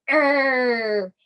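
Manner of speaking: "err" as one long, growly vowel; phonics sound. Shown as a demonstration. Teacher showing what I and R say, drawn-out "err". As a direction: hold "err" out like a bear's growl. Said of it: "I R together says".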